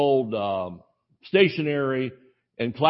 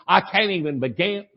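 put-on Tennessee accent; 5 semitones higher than usual. Secretary warning the new boy, in a Tennessee accent. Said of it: "in Tennessee, Tennessee, Cleveland, Tennessee accent".

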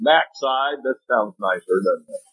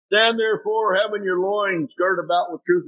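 emphatic scripture reading; 5 semitones higher than usual. Acted. Preacher reads scripture.

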